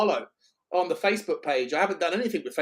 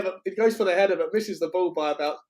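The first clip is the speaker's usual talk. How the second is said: normal talking voice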